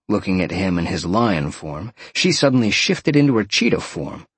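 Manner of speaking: ordinary delivery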